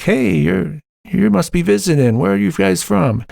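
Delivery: mock-chirpy small talk with strangers; imitating friendly welcome. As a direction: act out a keen hello to visitors. Imagined welcome from ward members.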